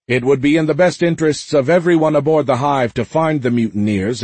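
mildly forceful public address; commanding captain's announcement. A narrator's reading.

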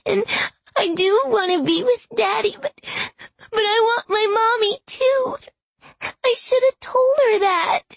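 put-on very high, tearful little-girl wail; sobbing child. A narrator's reading. Granddaughter pours out wanting both parents, high sobbing child's voice. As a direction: plead as a small child through sobs.

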